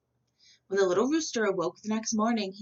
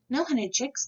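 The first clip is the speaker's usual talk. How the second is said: sighing; 6 semitones higher than usual. A narrator's reading. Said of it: "the Galito sighed".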